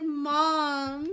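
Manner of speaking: slow, loud, high-pitched mock baby protest; grumpy baby voice. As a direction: speak as the baby, whining loud and high at mom. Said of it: "He's like".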